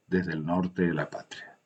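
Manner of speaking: usual speaking voice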